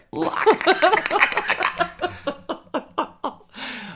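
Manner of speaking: high loud laughter; laughing fit. Real, not acted. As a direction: burst out laughing.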